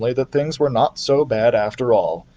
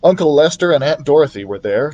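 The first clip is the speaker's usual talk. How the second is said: usual speaking voice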